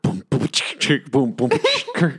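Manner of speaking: slow, halting beatbox attempt; rough beginner's mouth percussion; clumsy beatboxing. Shown as a demonstration. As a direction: copy a beatbox pattern badly. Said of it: "I need to hear you so I know you got it".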